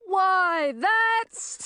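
high, loud, indignant sputter; flustered high-pitched outburst. A narrator's reading. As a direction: blurt it high and break off, lost for words. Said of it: "sputtered Chlorine".

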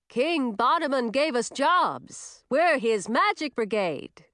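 slow, high, proud witch voice; boastful, slightly reined-in holler. A narrator's reading. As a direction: announce it proudly, drawn out but short of a bellow. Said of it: "said proudly in a quieter voice that was still louder".

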